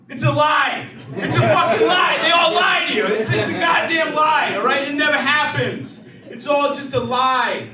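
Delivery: angry rant. Acted. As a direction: rant it out, furious. Ranting at the crowd.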